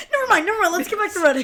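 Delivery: high, loud imitated dismissal; flustered brush-off. Imitating Monk dropping the dance talk for running, flustered.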